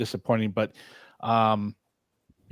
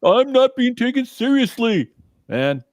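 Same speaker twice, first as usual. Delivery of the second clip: high whiny reading of a text; reading Helper's reply; 11 semitones higher, 6 dB louder than usual. Retold or imitated. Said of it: "Helper is responding".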